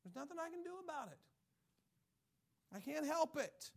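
high, whiny pleading voice; helpless whiner. Retold or imitated. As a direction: whine like you've given up. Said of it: "You know who talks like that?".

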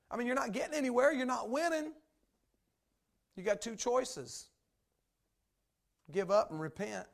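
high, loud, fast rebuke; loud, high preacher's rebuke; preaching with force. Real, not acted. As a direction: drive the point home hard.

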